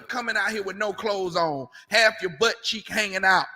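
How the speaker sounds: ordinary talk, nothing marked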